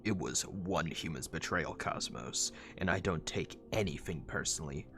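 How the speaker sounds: curt, low robot partner; Prowl. Acted. Prowl shutting down Cosmos's small talk, low and curt.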